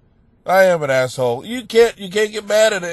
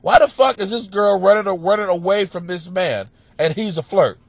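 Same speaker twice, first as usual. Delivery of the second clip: ordinary delivery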